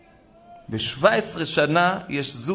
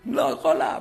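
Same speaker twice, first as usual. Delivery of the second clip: exhausted gasp; 4 semitones higher than usual. Retold or imitated. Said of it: "היא נותנת צרחה".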